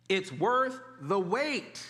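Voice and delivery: slowly stretched "It's worth the wait", weight on worth; slow repeat. Shown as a demonstration. Pastor repeating the promise to the congregation, slow and deliberate. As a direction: lay it down word by word. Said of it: "What did I say?".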